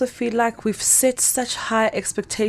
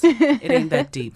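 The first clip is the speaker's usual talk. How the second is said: emphatic; 6 semitones higher than usual. Shown as a demonstration. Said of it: "repeats her advice with emphasis".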